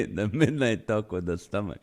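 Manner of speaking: usual speaking voice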